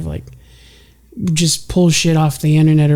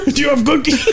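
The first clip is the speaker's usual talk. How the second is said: laughing mock question; 8 semitones higher than usual. Mock quote while laughing.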